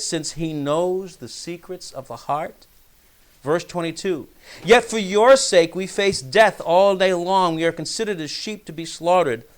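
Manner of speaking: measured, solemn Bible reading; scripture reading. Acted. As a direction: read the psalm out to the congregation. Reads the psalm aloud.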